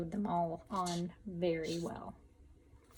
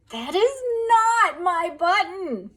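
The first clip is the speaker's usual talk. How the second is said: high, loud, slow wail; 10 semitones higher, 11 dB louder, slower than usual. A narrator's reading. Toad wails at the raccoon, slow high wail. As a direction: stretch it into a wail. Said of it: "wailed Toad".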